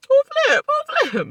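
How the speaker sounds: very high, loud mock squeak; mock confused Kyle. Mocking Kyle looking round the house, very high and loud. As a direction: squeak it like a lost deer.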